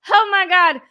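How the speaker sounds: real astonished outburst; amazed exclamation. Real, not acted. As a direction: burst out, thrilled and stunned. Repeats it, more amazed.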